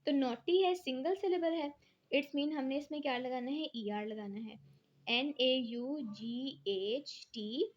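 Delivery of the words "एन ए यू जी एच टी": slow spelling. Shown as a demonstration. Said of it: "teacher spelling out the word".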